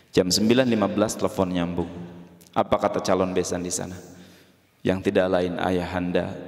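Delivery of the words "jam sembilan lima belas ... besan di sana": ordinary talk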